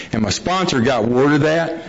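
normal talking voice

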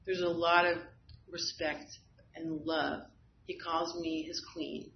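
ordinary talk, nothing marked

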